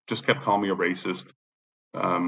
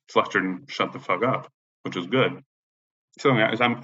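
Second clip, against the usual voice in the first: ordinary talk, nothing marked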